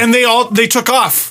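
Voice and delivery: ordinary talk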